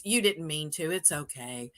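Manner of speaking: soft, low reassurance; quiet comforting voice. Retold or imitated. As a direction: soothe her gently like a hugging stranger. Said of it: "he goes".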